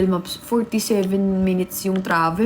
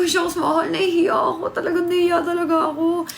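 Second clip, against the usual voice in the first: embarrassed whine; 7 semitones higher than usual. Retold or imitated. Embarrassed at being late.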